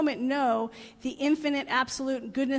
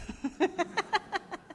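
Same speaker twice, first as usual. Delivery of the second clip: real high, rippling laugh; amused laugh; 6 semitones higher, 4 dB quieter than usual. Real, not acted. Speaker to audience after joking about appearances, amused laugh. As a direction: laugh in a run of quick pulses.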